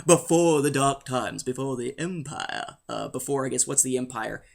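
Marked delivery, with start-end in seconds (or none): 0.0-2.8 old mentor impression. Joking about dark times before tapes, solemn mock film quote.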